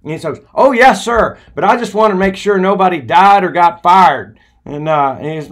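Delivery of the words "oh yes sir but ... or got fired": high, loud, innocent-sounding jab; cheeky young Les. Retold or imitated. Les needling the manager about a job, cheeky. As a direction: play it sweet but sly.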